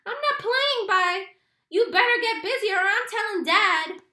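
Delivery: high, loud furious threat; angry shout. A narrator's reading. Little brother threatens Byron he'll tell Dad, shouting. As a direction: yell a threat at him.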